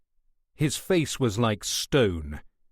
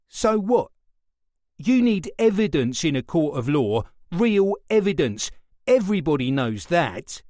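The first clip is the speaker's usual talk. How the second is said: high, loud and scornful; defiant retort; 5 semitones higher, 4 dB louder than usual. A narrator's reading. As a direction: throw it back at him. Suspect defiant.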